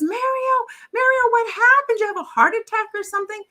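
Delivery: high-pitched worried friend; alarmed concern. Acted. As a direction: act out panic over a collapsed friend. Alarmed friend finds him collapsed.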